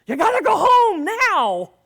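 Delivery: high, loud alarmed yell; panicked shout. Retold or imitated. Paranoid coworker yelling at the door to leave. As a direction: burst in yelling the warning. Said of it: "comes busting to my door".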